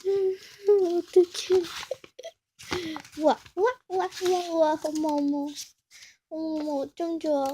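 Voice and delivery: high, delighted oohs and wows; amazed gasps. Real, not acted. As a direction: squeal with joy at the gift. Amazed opening the package.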